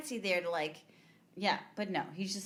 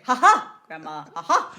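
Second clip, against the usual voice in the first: mock taunting laugh; 2 semitones lower, 12 dB louder than usual. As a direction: blast a fake laugh at grandma. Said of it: "You wanted him to? That would have been great".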